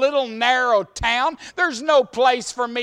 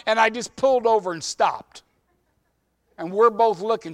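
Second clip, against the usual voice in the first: normal talking voice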